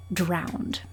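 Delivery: ordinary delivery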